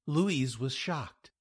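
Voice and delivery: plain reading voice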